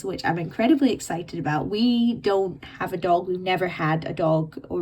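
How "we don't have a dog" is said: usual speaking voice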